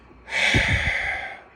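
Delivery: loud, long sigh; heavy, breathy exhale; exasperated sigh. Acted. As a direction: heave a big audible sigh. Guardian finds her charges gone.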